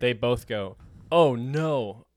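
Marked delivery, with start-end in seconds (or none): 1.1-2.1 all-caps cry; loud "oh no". Retold or imitated. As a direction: blurt it out loudly. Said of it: "bolded all caps".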